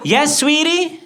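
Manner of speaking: high, lilting mom voice; sweet indulgent mother. Acted. American mother answering her yelling son, sweetly indulgent.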